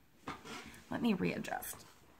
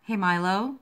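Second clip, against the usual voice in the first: louder call to stop barking; calling to dog; 2 semitones higher, 7 dB louder than usual. Real, not acted. Telling Milo the barking dog to stop, raised voice.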